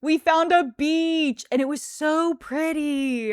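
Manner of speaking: high, slow delight at finding the beach; excited. Real, not acted. Telling viewers about the beach, bright and excited. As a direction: savour each word, thrilled.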